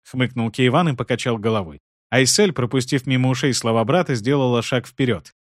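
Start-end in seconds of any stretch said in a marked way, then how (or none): none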